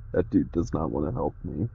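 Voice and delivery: normal talking voice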